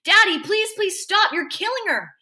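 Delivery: put-on high, urgent child's plea; desperate pleading. A narrator's reading. Boy begging his father to let go of her, desperate pleading. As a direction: beg him to stop, loudly at first.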